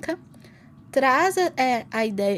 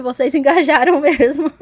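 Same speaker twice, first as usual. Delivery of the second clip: laughing; 4 semitones higher, 13 dB louder than usual. Real, not acted. Laughing about dogs voting.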